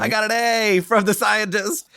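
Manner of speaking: high, squeaky boast of a proud pupil; mock proud student. Host teasing his guest with a proud-pupil voice. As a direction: gloat like a kid with a gold star. Mocking teacher's pet pride.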